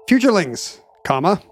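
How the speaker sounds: spoken punctuation, every mark read aloud; dictating. Pretend. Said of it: "reading punctuation aloud".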